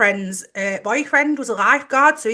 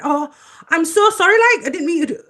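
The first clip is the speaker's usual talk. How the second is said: higher, apologetic Geordie lad; Geordie accent; 7 semitones higher, 5 dB louder, faster than usual. Retold or imitated. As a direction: imitate a Geordie saying sorry. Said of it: "I'm not gonna do a Geordie accent again".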